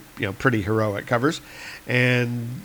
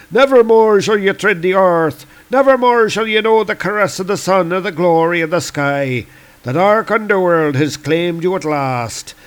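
higher, grandly theatrical doom speech; gloating Celtic god; 6 semitones higher, 3 dB louder than usual. Acted. Lear over the buried Thor, triumphant declaiming. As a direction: pronounce the doom with relish.

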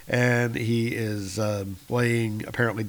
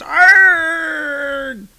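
much higher, louder scream of agony; pained scream; 9 dB louder than usual. Acted. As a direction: scream as the bolt burns your face. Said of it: "and he goes".

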